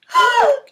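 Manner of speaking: high loud theatrical gasp; big gasp. Pretend. Teacher gasping at the blurb, loud and high. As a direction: suck the air in, shocked.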